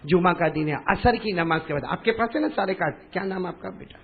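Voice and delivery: ordinary delivery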